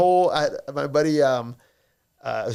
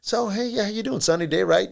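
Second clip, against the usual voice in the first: higher chirpy salesman pitch; 7 semitones higher than usual. Retold or imitated. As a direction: sell it with a bright pushy lilt. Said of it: "that's like a sales technique when you're like".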